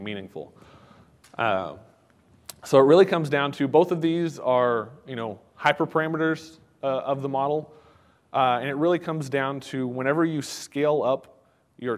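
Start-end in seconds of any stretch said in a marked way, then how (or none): none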